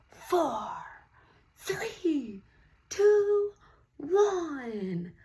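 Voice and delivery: loud, high, slow race announcer calling the countdown; booming announcer. Acted. Announcer calling racers to the start, booming and slow. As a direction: boom it out over the whole track. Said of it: "the announcer's big booming voice called out".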